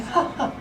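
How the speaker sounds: soft, breathy, high mock laugh; imitated laugh. Mimicking a colleague's reply to a Yankees fan, soft breathy laugh. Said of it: "she said".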